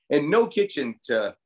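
ordinary talk, nothing marked

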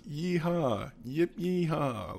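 a light, stretched imitation cowboy holler; high-pitched yeehaw. Mimicking a movie gunslinger, high mock yeehaw. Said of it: "like, really, really high-pitched".

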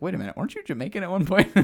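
high, quick, incredulous question; mock puzzlement. Retelling someone questioning Kofi's accent, mock puzzlement. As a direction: blurt it out as if just noticing.

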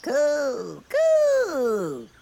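high flirty cooing lady; man doing a woman's voice. Acted. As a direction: coo it like a coquettish bird lady. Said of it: "with his most ladylike hip shake".

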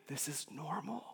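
near-whispered calm comfort; gently, almost under the breath; hushed reassurance. Retold or imitated. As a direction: drop to a soft, knowing murmur. Voices old pastor reassuring him.

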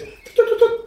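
high, tutting bird call; bird chirp. Acted. Game master voicing a small bird flitting past, high chirps. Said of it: "a bird flitters past it and goes".

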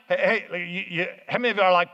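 ordinary talk

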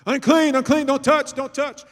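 high, loud shouted warning; calling out unclean. Retold or imitated. Crowd calls out to keep the woman back, loud warning shout. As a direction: cry out to keep her away. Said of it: "They called it out".

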